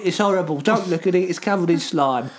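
put-on little Cockney voice; Cockney accent. Disgusted at something covered in slime, mock Cockney accent. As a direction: play it as a comic Cockney grumbler. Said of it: "I like that accent".

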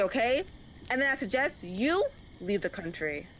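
ordinary delivery

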